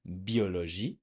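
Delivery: Biologie as bee-o-lo-ZHEE, rising at the end; French pronunciation. Shown as a demonstration. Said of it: "the I has to be French".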